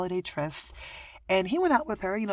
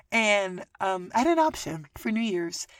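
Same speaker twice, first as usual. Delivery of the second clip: ordinary delivery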